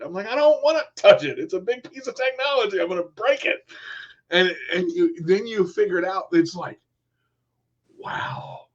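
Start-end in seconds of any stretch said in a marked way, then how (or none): 0.3-3.6 mock panic. Refusing scary technology. 8.0-8.7 breathy whispered wow. Real, not acted. Awe at technology.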